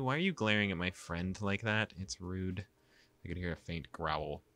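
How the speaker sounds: usual speaking voice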